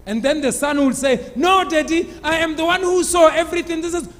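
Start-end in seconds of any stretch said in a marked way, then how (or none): 1.3-4.1 put-on high, quick child's protest; lightly voiced insistent boy; eager interruption. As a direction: cut in excitedly as the son. Son interrupts father's telling, acted.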